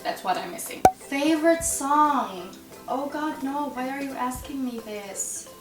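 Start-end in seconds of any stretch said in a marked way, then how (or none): none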